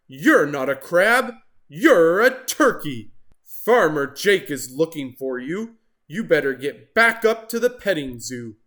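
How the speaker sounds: loud, stern telling-off; stern lifeguard. Acted. Lifeguard scolding Turkey, stern and loud.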